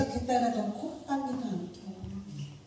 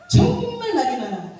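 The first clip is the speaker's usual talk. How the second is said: high, loud, laughing outburst; laughing exclaim; 11 semitones higher, 5 dB louder than usual. Real, not acted. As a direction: burst out laughing at how much they talk. (웃음 터지며 높고 크게)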